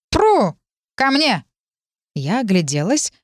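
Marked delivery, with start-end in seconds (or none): 0.1-1.4 sharp call to the broom. Acted. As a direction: shout for the broom to come. Cat calls the broom. (зов метлы)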